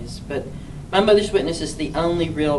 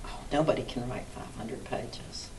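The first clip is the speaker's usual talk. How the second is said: softer breathy whisper of doubt; whispered disbelief; 5 semitones lower, 13 dB quieter than usual. Retold or imitated. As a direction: whisper it like it's impossible. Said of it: "And I'm like".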